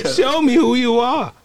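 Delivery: very high, loud, slow pleading; falsetto character voice. Acted. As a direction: squeal it out slowly. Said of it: "can do voices so good".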